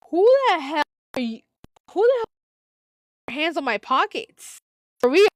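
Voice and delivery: hammed-up furious challenge; angry confrontation. Confronting Courtney over pockets.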